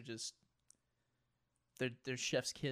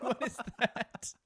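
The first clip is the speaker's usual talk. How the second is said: confused; 9 semitones higher, slower than usual. Retold or imitated. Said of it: "I was like".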